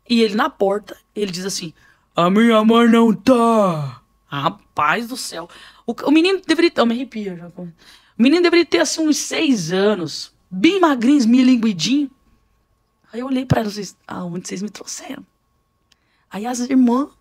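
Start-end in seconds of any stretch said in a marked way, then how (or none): 2.2-4.0 put-on slow, slightly louder small-boy voice; lightly voiced boy, slow and deliberate; child's voice. Boy at the door speaks. 14.1-15.3 alarmed whisper to the sisters; whispered aside. Retold or imitated.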